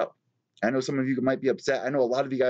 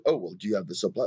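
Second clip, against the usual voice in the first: snooty mock; 5 semitones lower, faster than usual. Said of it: "an attitude like".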